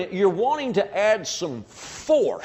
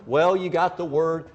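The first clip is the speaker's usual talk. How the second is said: usual speaking voice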